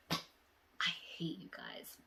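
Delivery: slow breathy grudge; whispered mock hate. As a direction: pretend to hate them.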